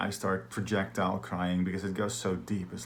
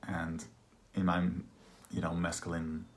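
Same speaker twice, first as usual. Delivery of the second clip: ordinary delivery, nothing marked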